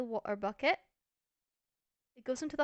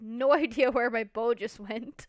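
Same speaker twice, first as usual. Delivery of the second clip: louder, breaking into a laugh; 8 dB louder than usual. Real, not acted. Gamer realising the bow is lost, laughing. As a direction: laugh through the admission.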